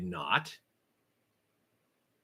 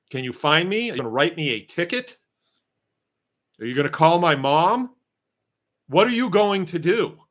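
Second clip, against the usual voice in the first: higher, louder taunting questions; defiant taunting; 6 semitones higher, 4 dB louder than usual. Retold or imitated. Farmer taunting the inspectors with defiant questions. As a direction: dare them to do anything.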